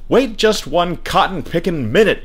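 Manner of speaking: high, exasperated call; calling after someone. A narrator's reading. Father calls after his son, high and exasperated. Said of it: "he would call after Peter".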